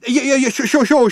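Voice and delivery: high, rapid-fire placating; hurried interruption. A narrator's reading. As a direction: cut in hastily to stop the shouting. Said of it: "interrupted Ford hurriedly".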